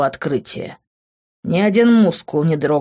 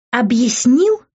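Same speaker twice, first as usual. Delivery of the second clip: higher, incredulous indignant question; 7 semitones higher, 4 dB louder, slower than usual. A narrator's reading. Angry girl echoes his excuse, indignant. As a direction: throw the word back in outrage. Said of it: "возмутилась она".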